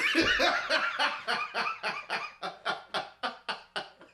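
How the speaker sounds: loud, high cackling laugh; burst of laughter. Real, not acted. Podcaster cracking up at friend's jab, loud laughter. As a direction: crack up hard and long.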